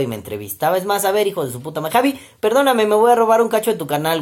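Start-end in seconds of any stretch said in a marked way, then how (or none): 1.9-4.2 excited. Real, not acted. Mock apology to friend.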